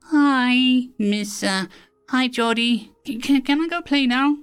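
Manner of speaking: put-on high, stammering little boy; a shy small kid asking to run off; shy child voice. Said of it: "He peeked her head around".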